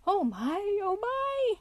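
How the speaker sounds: high-pitched, wide-eyed gasp of wonder; gasping amazement. A narrator's reading. Mole marvelling at each fresh revelation, gasping high. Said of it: "still gasping".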